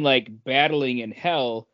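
ordinary talk, nothing marked

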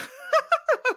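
high nervous laugh turning to a cry. Retold or imitated. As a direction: panicky laugh near tears. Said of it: "nervous laugh cry".

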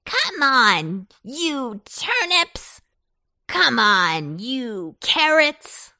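loud, drawn-out pumpkin call; throaty creature hollering a rally; rallying cry. A narrator's reading. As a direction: summon followers, stretching each name. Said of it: "cried Pumpkin".

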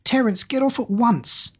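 high-pitched urgent command; acted high-pitched telling-off; alarmed scolding. A narrator's reading. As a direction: order the dog off in alarm. Scolding dog attacking the doll.

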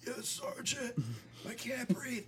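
high, wheezy breathless voice; breathless gasping. Retold or imitated. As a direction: squeak it out, winded. Soldier gasping after fall.

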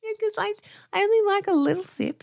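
high squeaky voice. Real, not acted. Defending small sips.

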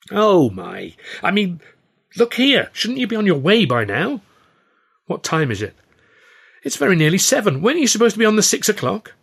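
high, loud fussing; flustered friend. A narrator's reading. Stan flustered that Arthur will miss his boat, high fussing.

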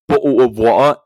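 loud mock British, t's dropped; British accent. Shown as a demonstration. Quoting what Americans deny saying, mock British accent. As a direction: blurt it in a put-on British accent. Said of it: "in a British accent".

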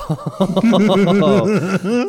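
loud laugh of sudden realisation; embarrassed laugh. Real, not acted. Realising he ditched his date, embarrassed loud laugh. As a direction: burst out laughing at yourself.

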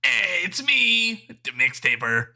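high, slow, drawn-out grand intro; silly announcer voice. Pretend. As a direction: play it up like a big entrance. Playful self-introduction with a put-on voice.

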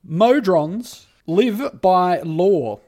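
slow, weighty pronouncement; solemn demo sentence. Shown as a demonstration. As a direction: intone it like a grand decree. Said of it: "use it in a sentence?".

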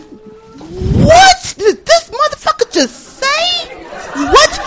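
very high, stretched-out shocked question; outraged disbelief. Acted. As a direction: squeal it slowly in stunned disbelief. Acted shock at racist remark.

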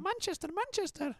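put-on high, eerie child voice; a spooky little boy calling out; creepy kid voice. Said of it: "keep doing a creepy kid voice".

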